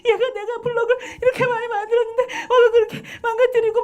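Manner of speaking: put-on loud, very high-pitched whining kid voice; worked-up child. Child telling parent about broken blocks, agitated. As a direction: imitate an upset child tearfully complaining. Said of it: "막 흥분해서 막".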